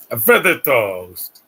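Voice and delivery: playful slow mock voice; put-on voice. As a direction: drag the words out in a mock character voice. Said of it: "I'm talking like this".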